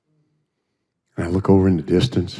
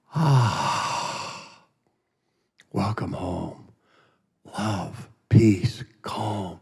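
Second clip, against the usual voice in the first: hushed awe; 8 dB quieter, much slower than usual. Retold or imitated. Awed memory of arriving in heaven.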